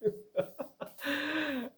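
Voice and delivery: high, sheepish laugh at himself; a real, self-mocking chuckle; rueful laugh. Real, not acted. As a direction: laugh it off, embarrassed. Laughs calling himself bad husband.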